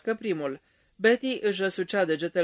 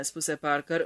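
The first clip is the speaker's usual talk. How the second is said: usual speaking voice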